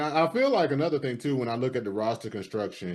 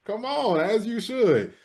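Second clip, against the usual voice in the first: high-pitched, protesting come-on; exasperated appeal; 12 semitones higher, slower than usual. Retold or imitated. Host dismissing easy preseason dominance, exasperated appeal. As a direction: plead the obvious, pitched way up.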